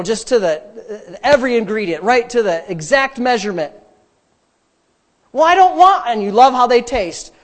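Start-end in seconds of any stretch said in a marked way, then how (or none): 5.3-6.1 high, loud mock protest; whiny objector. Balking at grandma's recipe, whiny objection. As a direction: balk like a fussy complainer. Mimics a reluctant recipe user.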